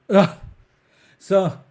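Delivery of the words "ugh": grunt ending. Shown as a demonstration. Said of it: "wrap up their final phrase with a".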